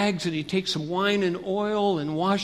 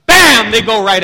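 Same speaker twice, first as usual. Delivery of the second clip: a big startling shout; sudden loud bam; 5 semitones higher, 17 dB louder than usual. Acted. Storyteller springs the thieves' ambush on kids, sudden shout. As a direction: burst out loud and high to make listeners jump.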